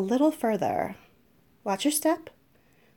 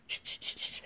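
soft unvoiced shh; whispered shushing; 12 dB quieter, faster than usual. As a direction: hush them in a whisper. Hushing while sneaking off.

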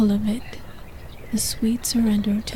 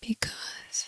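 very soft, breath-only reply; whisper; 13 dB quieter than usual. A narrator's reading. Lover answering the masked man's demand, in a whisper. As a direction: barely breathe the word out. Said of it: "you whisper".

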